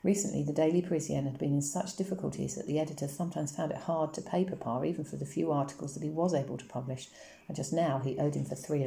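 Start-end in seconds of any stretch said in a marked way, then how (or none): none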